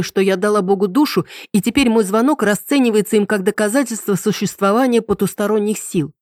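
usual speaking voice

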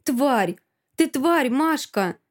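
high, loud outraged cry; squealing insult. A narrator's reading. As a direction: hurl it as a shrieked insult. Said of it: "верещит она".

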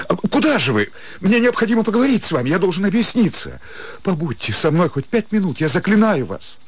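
urgent pleading. Acted. Startsev begging Kotik to stay, urgent and rushed. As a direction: plead fast before she runs off.

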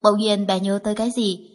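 ordinary delivery, nothing marked

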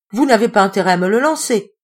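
loud playful warning; smiling exclamation. Acted. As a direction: warn him off with a smile. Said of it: "s'exclama-t-elle en souriant".

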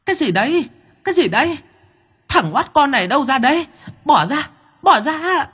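very high, panicky ghost voice; flustered ghost. Acted. Ghost woman caught by the little demon, flustered and high. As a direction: squeal in confusion as your hair is yanked. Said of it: "Con vong kia luống cuống".

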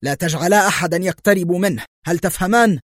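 high, forceful command; shouted order. A narrator's reading. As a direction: call out sharp orders while leaving. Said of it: "صاح جوزيه في حارس الزنزانة".

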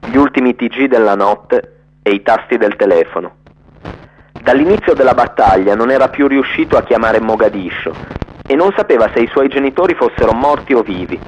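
usual speaking voice

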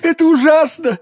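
high-pitched dying man's lament, climbing to a wail; anguished, desperate outcry; agonized accusation. A narrator's reading. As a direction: accuse your poisoner in agony. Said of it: "вскричал Бризмон".

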